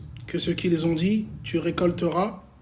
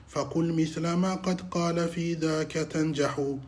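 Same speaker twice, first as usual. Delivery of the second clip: low, soft, even-toned Arabic recitation; formal recitation; 5 semitones lower, 8 dB quieter, faster than usual. Acted. Teacher opens the lesson with praise and verse, formal recitation. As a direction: recite the opening formula and verses quickly, softly and evenly.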